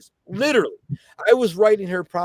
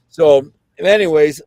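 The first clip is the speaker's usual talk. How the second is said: usual speaking voice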